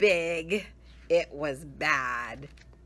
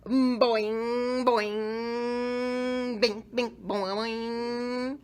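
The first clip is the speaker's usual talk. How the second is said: high, bouncy spring sounds; cartoon boing noises; springy boings; 6 semitones higher, 3 dB louder, much slower than usual. A narrator's reading. Hair springing as it dries.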